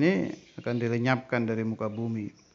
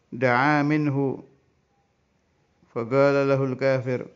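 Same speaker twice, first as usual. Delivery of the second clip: slow, raised Arabic reading voice, drawn out; kitab recitation; 4 semitones higher, 6 dB louder than usual. Acted. Teacher reading the Arabic kitab to students, slow raised recitation. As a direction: intone the Arabic text like a pesantren teacher.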